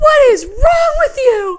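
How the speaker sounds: slow, very high, loud wail; re-enacted hysterical sobbing outburst; bawling. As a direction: wail the question as a tearful scolding. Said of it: "And I'm bawling".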